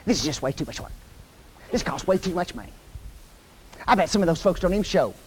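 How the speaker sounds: mock grumble to yourself while working; quietly acted host's complaint; grumbling under breath. Grumbling host acted while cooking.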